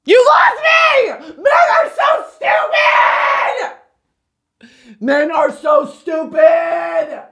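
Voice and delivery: high, loud, slow wail of disbelief; exasperated shriek. Acted. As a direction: screech it like you've given up on men.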